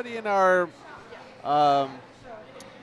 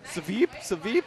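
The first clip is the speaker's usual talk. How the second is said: put-on Russian-accented curling call; Anna Sidorova. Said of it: "I see Anna Sidorova".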